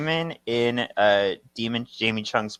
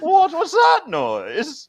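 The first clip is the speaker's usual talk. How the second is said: much higher, louder, slow startled lady; squeaky party lady; 8 dB louder, slower than usual. Retold or imitated. Partygoer asks the crowd about the boom, high and startled. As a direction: play a shocked partygoer, very high. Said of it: "the guy says".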